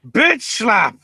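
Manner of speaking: high, loud burst calling the slap; excited shout. Retold or imitated. Recapping the match to his co-host, an excited high shout. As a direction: yell it like the slap just landed.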